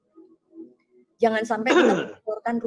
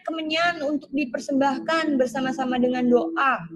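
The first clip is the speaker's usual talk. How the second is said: louder, slow, solemn reading of the verse; scripture reading; 5 semitones higher, 5 dB louder than usual. Acted. Preacher reads the altar verse to the congregation, solemn. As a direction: lift the Bible words up with weight.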